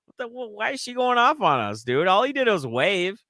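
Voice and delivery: high-pitched retold bewilderment; mock shock, voice leaping up; baffled disbelief. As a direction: protest in a high, incredulous voice. Retold shocked reaction to being cursed.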